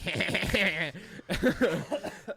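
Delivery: high, loud mock cackle; imitated gleeful cackle. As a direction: cackle like a troll poking the bear. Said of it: "Like, I'm like".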